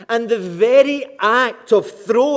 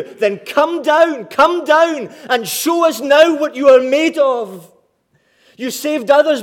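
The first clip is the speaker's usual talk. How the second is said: hammed-up crowd taunting, loud and raised; mocking taunts; 6 semitones higher, 5 dB louder than usual. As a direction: jeer loudly at a helpless man, pitched high. Preacher acts out mockers' taunts.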